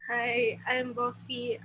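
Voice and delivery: usual speaking voice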